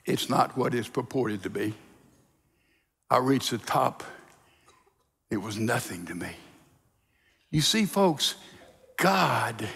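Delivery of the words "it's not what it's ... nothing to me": low, soft, subdued admission; disillusioned confession. Acted. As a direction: admit it quietly, voice sinking.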